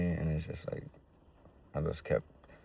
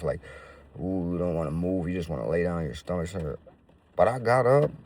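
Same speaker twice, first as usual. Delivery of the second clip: ordinary talk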